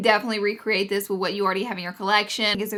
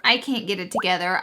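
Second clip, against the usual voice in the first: ordinary talk, nothing marked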